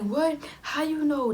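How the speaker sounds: high, startled questioning; high-pitched retold surprise; imitated surprised mom. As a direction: act out a mom's disbelief. Mom reacting to baby news.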